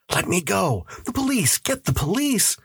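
very high, frantic plea; high-pitched hysteria without extra volume; panicked cry. Acted. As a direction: cry for help while struggling to get free. Man struggling free, calling for police.

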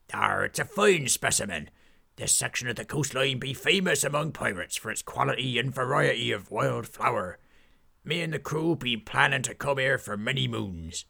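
put-on brief the lads like an old sea dog; pirate captain. Pirate captain sending his crew flower hunting.

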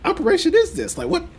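mimicking Edgar's baffled question about truffles, high and incredulous; incredulous mock voice. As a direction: play the baffled friend, climbing in pitch with disbelief. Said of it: "was like".

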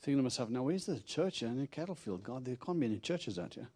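usual speaking voice

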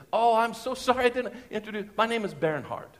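imitated German accent, courteously apologising. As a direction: put on a polite German accent. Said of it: "it was a German accent".

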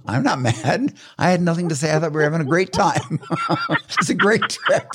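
high-pitched mock protest dissolving into laughter; protesting, laughing. Daughter telling mom she had a great time, retold laughing protest. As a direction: imitate an innocent denial, cracking up.

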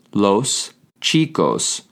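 slow, with a stretched final s in "chicos"; slow drawn-out repeat. Shown as a demonstration. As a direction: repeat "Los chicos" slowly, holding the last s. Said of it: "you say".

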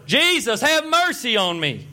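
high, loud, slow plea. Retold or imitated. Blind man shouting over the crowd to Jesus, loud high plea. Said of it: "He got even louder".

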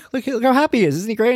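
high, bright bluffing enthusiasm; fake cheerful. Dad distracting the teacher at the door, high fake cheer.

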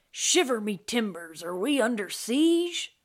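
loud, alarmed pirate voice, rising high; exclaiming pirate. A narrator's reading. Crewman fearing the ship is under siege, exclaiming. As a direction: exclaim like a startled old-time sailor. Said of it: "A flood of feet and exclamations".

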